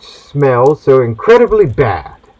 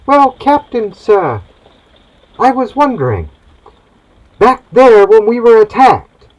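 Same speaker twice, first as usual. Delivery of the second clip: put-on much higher, timid young boy; cabin boy voice; 10 semitones higher, 4 dB louder than usual. Cabin boy asking his captain a question, high and eager. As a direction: pipe up like a nervous boy sailor.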